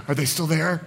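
soft, hushed, meek voice backing away; timid mock Jesus. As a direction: hum nervously and hope they leave. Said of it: "He doesn't do this".

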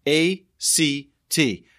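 "A C T" letter by letter, slow and firm; spelled out slowly. Shown as a demonstration. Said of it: "and that's act".

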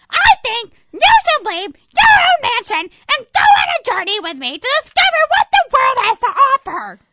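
very high, very loud fly; shouting fly. Acted. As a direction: shriek it like an angry insect. Fly yells at Flea.

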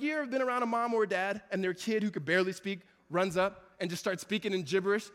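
usual speaking voice

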